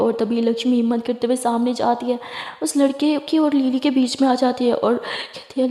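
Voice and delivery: ordinary delivery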